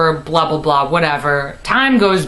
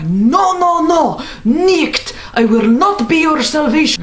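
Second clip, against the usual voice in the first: Russian landlady; 9 semitones higher than usual. Retold or imitated. Landlady pacing and shouting into the phone in a mock Russian accent. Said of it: "deep chain smoker voice".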